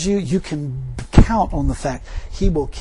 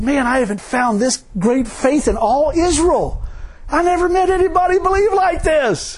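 high, louder amazed exclamation; excited Jesus; 10 semitones higher, 3 dB louder than usual. Acted. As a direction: marvel out loud, thrilled by this faith. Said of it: "He was excited".

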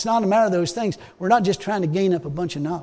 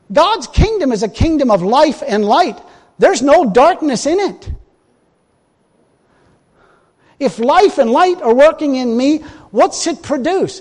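raised, loud preacher's cry building; preaching at full force; 6 semitones higher, 6 dB louder than usual. Acted. Preacher proclaiming to the congregation, loud and high.

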